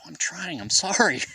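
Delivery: high-pitched, pleading mock apology; flustered apology. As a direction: imitate a flustered, defensive sorry. Retold flustered apology to friend.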